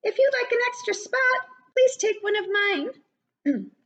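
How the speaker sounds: very high, fast twittering bird voice; chirpy bird. Acted. Bella Bird offering Lucy a spot, very high and fast.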